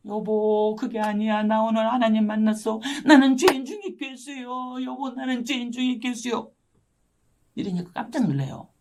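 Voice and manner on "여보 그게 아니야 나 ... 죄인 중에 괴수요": drawn-out wailing plea on one level tone; retold weeping confession. Wife on her knees confessing to startled husband, re-enacted tearful wail. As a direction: act out sobbing a confession, stretching the words. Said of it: "막 엉엉 울으니까".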